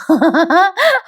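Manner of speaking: high-pitched real giggle; amused laugh. Real, not acted. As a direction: laugh at the taunt just delivered. टोमण्यावर निवेदिका हसते. (उंच स्वरातलं खरं हसू)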